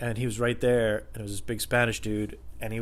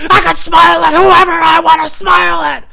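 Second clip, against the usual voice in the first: furious screaming; 16 dB louder than usual. Retold or imitated. Employee storming toward the customer, furious screaming. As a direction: re-enact snapping, screaming at full force. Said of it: "I was screaming my ass off".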